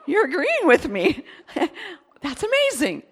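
high, loud, laughing disbelief; laughing amazed exclaim; delighted laugh. Retold or imitated. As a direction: burst with happy surprise. Delighted retold reaction.

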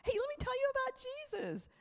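high playful storytelling voice for grandkids; voice for the kids. Shown as a demonstration. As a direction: talk up high like to little ones.

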